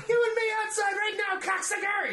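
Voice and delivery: very high, shouted challenge; mock threat. Imagined angry moviegoer challenging him, mock shout. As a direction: bark it like picking a fight.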